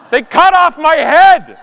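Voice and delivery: slow, loud indignation; indignant outburst. Real, not acted. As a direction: spit out the injustice. Outraged at a cropped viral photo.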